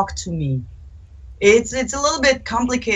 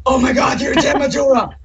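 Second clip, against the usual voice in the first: fan gushing, pitched up with a laugh; excited fan; 5 semitones higher than usual. Retold or imitated. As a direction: starstruck squeal of recognition. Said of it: "people were like".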